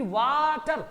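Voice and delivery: "वाटर" with a stretched वा, then टर; split into syllables. Shown as a demonstration. Teacher giving another example, "वाटर" as long वा-टर. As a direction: draw out वा in "वाटर" before टर. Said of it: "रुक के फिर बोलना पड़ता है ना".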